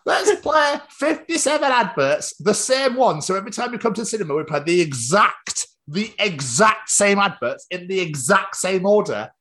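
high, silly management voice; hammed-up high voice of a broke cinema; mock cinema management. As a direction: draw out the stressed words in a high mock voice. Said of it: "Cinema had obviously gone".

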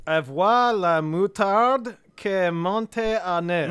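slow, clumsy French accent; terrible French accent. Shown as a demonstration. Host reads a French idiom to co-hosts, bad French accent. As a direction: read it out slowly in bad French. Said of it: "Your French accent is terrible".

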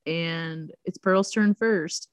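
ordinary talk, nothing marked

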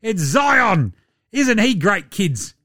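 hammed-up kids' show host; mock showman. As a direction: sell the star to the kids like a TV host.

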